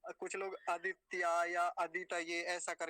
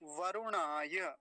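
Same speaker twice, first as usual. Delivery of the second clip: वरुणाय very slowly, syllable by syllable; slow part demo; much slower than usual. Shown as a demonstration. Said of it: "बस इतना बोलेंगे सबसे पहले". (वरुणाय धीरे धीरे)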